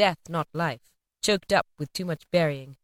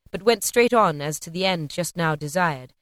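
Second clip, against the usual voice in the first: ordinary delivery, nothing marked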